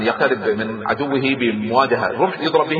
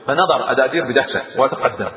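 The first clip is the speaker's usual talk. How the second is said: plain reading voice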